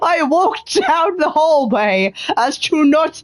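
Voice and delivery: can barely get the words out for laughing. Real, not acted. Gamer cracking up at his own Watson voice, laughing.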